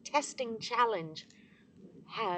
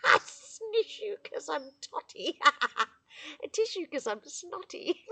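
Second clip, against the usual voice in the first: laughing through the words, higher; 8 semitones higher, 5 dB louder than usual. Real, not acted. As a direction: giggle at your own slip. Laughing over her spoonerism.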